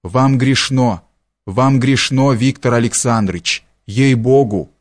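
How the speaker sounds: usual speaking voice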